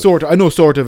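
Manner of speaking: a playful loud jab at the friend's hedge; mock echo. Real, not acted. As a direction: throw his words back at him, loudly.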